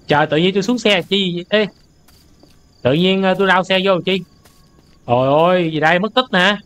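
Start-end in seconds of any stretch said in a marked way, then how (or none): none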